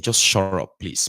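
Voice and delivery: ordinary talk, nothing marked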